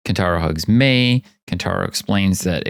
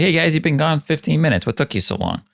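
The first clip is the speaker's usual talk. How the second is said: breezy, higher voice, oblivious to the worry; casual surprised greeting; 8 semitones higher than usual. Retold or imitated. Character greeting friends who searched for him, breezy. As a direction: act like nothing happened, chirpy and light. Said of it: "he's just like".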